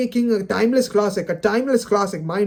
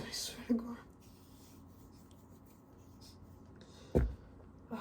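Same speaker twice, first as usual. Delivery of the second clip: whispered under the breath in disbelief; exasperated whisper; 3 semitones higher, 23 dB quieter, much slower than usual. Real, not acted. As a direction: breathe I swear to God out, barely audible. Exasperated aside after rant.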